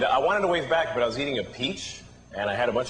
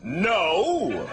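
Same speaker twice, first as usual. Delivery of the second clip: superhero; 5 semitones higher than usual. Acted. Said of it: "a superhero being propositioned by a hooker".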